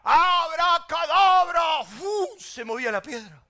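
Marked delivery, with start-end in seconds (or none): 0.0-1.8 high, loud, drawn-out incantation; magic-word shout. Retold or imitated. Bandit commanding the mountain to open, magic-word shout. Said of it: "uno que daba la palabra mágica y decía". 1.8-2.3 high-pitched "fu" whoosh; imitated whoosh. Storyteller mimicking a magic rock moving, imitated whoosh.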